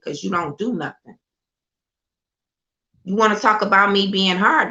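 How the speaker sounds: usual speaking voice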